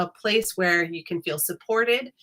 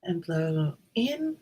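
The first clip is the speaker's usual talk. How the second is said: guided meditation. Acted. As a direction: ease listeners in, unhurried.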